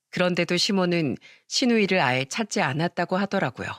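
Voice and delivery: plain reading voice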